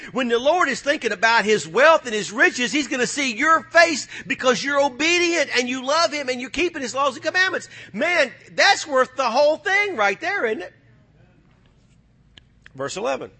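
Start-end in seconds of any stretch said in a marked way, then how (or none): 0.1-10.7 high, rising preacher's fervor; fiery preaching. Acted. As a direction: lift the room with it. Preacher climbing to a shout.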